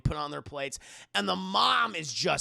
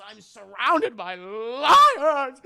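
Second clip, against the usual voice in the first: mock wail; 6 semitones higher, 12 dB louder, much slower than usual. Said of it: "starts fucking crying again".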